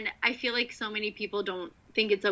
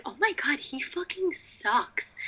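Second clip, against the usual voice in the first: high, slow, dragged-out disgust; disgusted realization; 7 semitones higher, 4 dB quieter, slower than usual. Retold or imitated. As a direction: let the disgust stretch out up high. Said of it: "But I was like".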